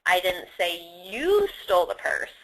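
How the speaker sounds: "you" lifted and held, rest falling low; stress on YOU. Shown as a demonstration. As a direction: lean long on "you", as if someone else did it. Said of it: "Of course, someone stole it".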